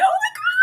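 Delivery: very high, loud schoolgirl squeal; excited squeal. Retold or imitated. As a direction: let out a mock squeal that builds to a shriek. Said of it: "she always was about to squeal".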